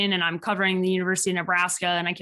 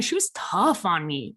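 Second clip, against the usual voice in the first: usual speaking voice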